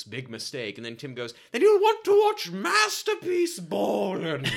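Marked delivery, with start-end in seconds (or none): 1.5-4.5 high, loud, slow fake English accent; hammed-up posh English accent, drawn out; bad English accent. Tim's terrible English accent for Al. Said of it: "That is the furthest thing from an English accent".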